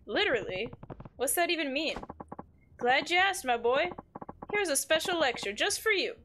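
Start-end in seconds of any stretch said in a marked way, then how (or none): 2.8-6.1 put-on higher, lilting old-timey villain; amateur voice acting of an old-timey villager. Streamer voicing a game villager's advice, old-timey villain. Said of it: "does kinda sound like an old-timey villain".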